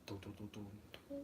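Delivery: slow, hushed suspense sting; mock suspense music; dramatic dun dun. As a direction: hum it like a cheesy movie cue.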